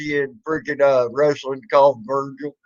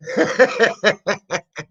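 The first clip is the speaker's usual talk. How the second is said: amused laugh; 2 semitones higher than usual. Real, not acted. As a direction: laugh off the troll. Laughing at the troll.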